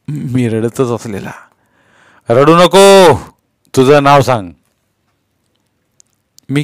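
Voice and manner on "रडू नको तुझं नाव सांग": loud, higher command; commanding officer's order; police inspector. A narrator's reading. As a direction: insist firmly to a crying child. Inspector orders the crying boy.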